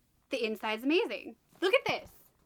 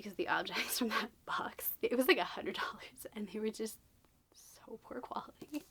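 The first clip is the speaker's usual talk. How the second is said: a salty whisper, voice dropped right down; whispered grumble; 3 semitones lower, 6 dB quieter than usual. Real, not acted. Booktuber whispering a grudge about a pricey box. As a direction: mutter the complaint under your breath.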